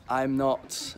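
usual speaking voice

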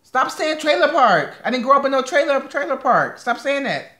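exasperated high-pitched objection; indignant protest. Real, not acted. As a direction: push back hard at the teasing. Protests partner's jab about accent.

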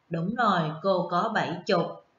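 ordinary delivery

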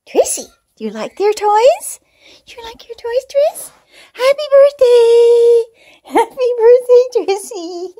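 squeaky sing-song coaxing for a kitten; pet talk. Real, not acted. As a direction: lure the cat to play, up high. Cooing birthday wishes to cat.

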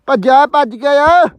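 loud call across the field. Real, not acted. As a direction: shout after someone running off. Shouting after the runaway Jindu.

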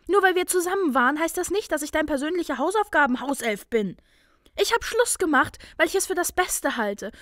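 high, firm rebuttal; controlled irritation. A narrator's reading. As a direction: argue back, keeping anger just in check. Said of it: "antwortete sie eine Spur gelassener".

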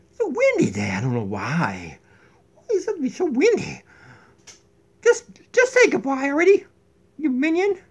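high, loud demanding voice; high bossy voice. Pretend. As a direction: boss the minion around. High queen voice complains.

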